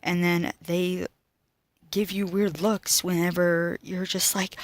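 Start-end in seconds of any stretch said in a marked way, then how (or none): none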